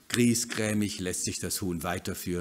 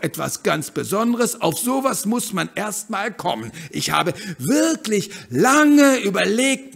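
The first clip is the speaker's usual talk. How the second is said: high, loud, bubbly hen voice; lively bossy hen; 12 semitones higher, 10 dB louder than usual. Acted. Hen eager to leave the backyard, loud and high. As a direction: push ahead full of cheer.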